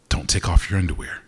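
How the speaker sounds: usual speaking voice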